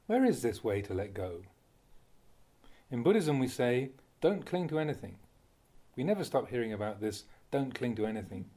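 usual speaking voice

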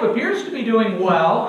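"appears" leaned on and lifted in pitch; stress on appears. Real, not acted. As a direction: make "appears" stand out from the rest. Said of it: "I emphasize the word appears".

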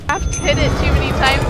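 ordinary talk

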